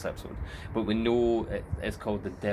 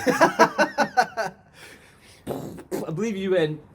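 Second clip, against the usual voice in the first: high, giggling mock accusation; laughing tease; 11 semitones higher, 6 dB louder, much slower than usual. Real, not acted. Host laughing at his co-host, high and giggly. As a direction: laugh through it at him.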